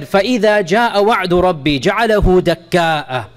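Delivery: chanted verse in a recitation voice; Quran recitation. Acted. Teacher reciting the promise verse for the class, in recitation. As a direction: recite it with tajweed, set apart from the talk.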